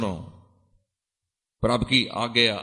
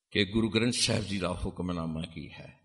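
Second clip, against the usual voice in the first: ordinary talk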